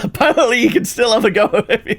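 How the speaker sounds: high and loud, laughing through it; real laughter at the mole's reach; laughing disbelief. Real, not acted. Laughing at surprise.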